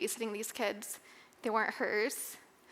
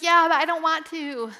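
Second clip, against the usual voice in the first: louder, higher, protesting she has a right to her anger; replaying her own angry reply to her husband; retold defiant anger; 4 semitones higher, 6 dB louder than usual. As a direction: push back hard, like a stubborn refusal. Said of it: "And I'm like".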